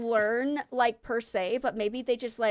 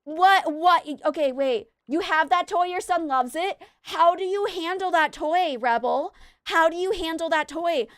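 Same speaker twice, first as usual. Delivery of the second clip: higher and louder, incredulous questions to a friend; excited disbelief; 6 semitones higher, 4 dB louder than usual. Retold or imitated. Asking Rebel about the screaming toy, excited and higher. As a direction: pepper her with amazed questions. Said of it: "And I'm like".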